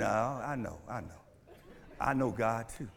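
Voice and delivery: low, soft, smooth reassurance; smooth caller. Retold or imitated. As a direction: lightly play the tempter. Tempter answers smoothly.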